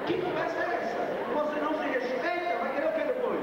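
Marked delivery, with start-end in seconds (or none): 0.1-1.0 higher, pointed and steady; firm rebuke. Acted. Preacher rebuking the congregation's excuse, firm. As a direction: repeat it as a firm challenge.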